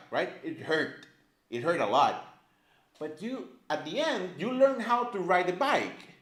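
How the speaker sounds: ordinary talk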